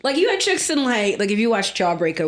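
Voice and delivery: ordinary talk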